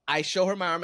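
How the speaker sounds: ordinary talk, nothing marked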